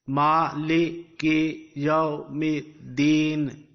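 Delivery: very slow, each syllable held apart. Shown as a demonstration. Teacher shows how to pronounce it, in syllables. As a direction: break it into separate drawn-out syllables. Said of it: "And in syllables, you will pronounce it".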